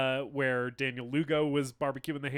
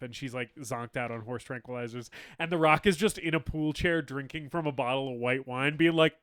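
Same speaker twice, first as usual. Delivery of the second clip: normal talking voice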